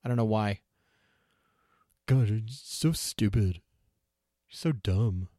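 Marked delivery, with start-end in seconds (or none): 2.1-5.3 put-on slow, sneering teen drawl; obnoxious teenager voice. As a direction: scoff like a bratty teenager. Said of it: "I should name that teenager voice".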